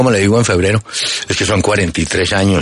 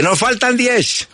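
mock sergeant; 13 semitones higher, slower than usual. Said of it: "cuando va, veinte".

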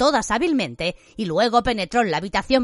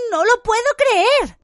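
much higher and louder, drawn out; shrieked disbelief; 14 semitones higher, 5 dB louder, slower than usual. A narrator's reading. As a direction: cry it out in shock. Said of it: "gritó Hermione".

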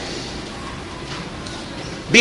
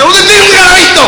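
very high, very loud mock fight; quarrelling; 13 semitones higher, 9 dB louder than usual. Shown as a demonstration. Teacher answers in the palito game peleando, loud mock quarrel. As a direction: yell it as if in a squabble. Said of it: "peleando así".

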